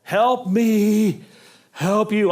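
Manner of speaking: pleading movie quote, pushed loud; Jerry Maguire line. Retold or imitated. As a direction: belt it like the desperate agent. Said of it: "from Jerry Maguire?".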